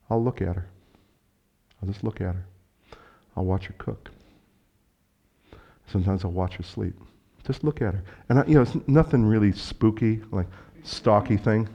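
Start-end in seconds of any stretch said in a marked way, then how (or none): 0.1-8.0 soft, lower, hushed affection; gentle, low-voiced fondness; tender. Real, not acted. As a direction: confide it quietly, like watching without disturbing her. Fondly describes quietly watching his wife.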